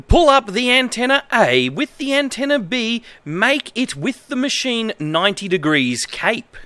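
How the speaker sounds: ordinary talk, nothing marked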